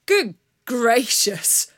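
loud, very slow "Good gracious"; a dashing outlaw feigning shock; hammed-up indignant gasp; mock-offended bandit.

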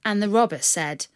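plain reading voice

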